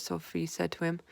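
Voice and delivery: ordinary delivery, nothing marked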